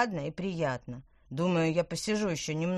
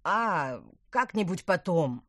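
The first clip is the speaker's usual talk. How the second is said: casual; 7 dB louder, slower than usual. A narrator's reading. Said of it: "как можно более непринуждённо".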